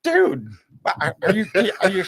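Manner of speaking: high, loud stammering disbelief; stunned disbelief. Retold or imitated. Telling a friend how floored he was, high stammering disbelief. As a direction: sputter out the words, floored. Stunned reply to odd question.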